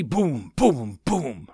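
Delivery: resonant imitated "boom"; drawn-out heavy booms; booming gunfire. Narrator imitating heavy machine guns, booming.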